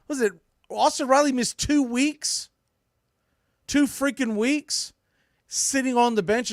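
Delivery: incredulous, fed-up rant about the bench; exasperated. Real, not acted. Ranting about a player benched too long.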